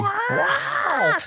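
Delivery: very high, loud wow; surprised shout. Real, not acted. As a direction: yelp out a stunned wow. Mock amazement at droid scream.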